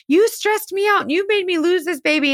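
a hint of a high whiny blame; lightly voiced accusing woman; mock accusing girlfriend. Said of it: "she's telling him".

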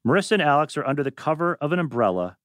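ordinary talk

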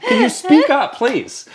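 high, loud, laughing exasperation; comic high-pitched complaint through laughter; exasperated mock plea. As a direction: plead loudly as a baffled viewer, pitch shooting up. Mock pleading with unintelligible TV actors.

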